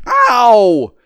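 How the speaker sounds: loud, high "ow" falling away; sudden loud cry of alarm; startled yelp. Acted. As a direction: yelp out in shock. Tramp startled by unseen voice.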